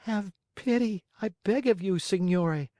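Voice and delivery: ordinary delivery, nothing marked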